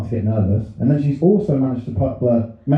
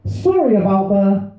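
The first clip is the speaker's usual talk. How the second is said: card line read higher and slowly; drawn out, a bit higher; reading mum's card; 7 semitones higher, slower than usual. Retold or imitated. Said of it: "she's written".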